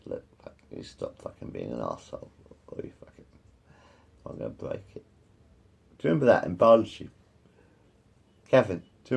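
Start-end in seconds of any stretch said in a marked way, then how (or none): 0.0-5.0 low, whispered menace, much softer; hushed retold threat. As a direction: hiss the threat quietly. Retold threat to schoolboy bully.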